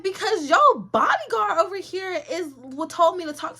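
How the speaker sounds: high, loud mock protest; indignant retort. Retold defensive answer to celebrity.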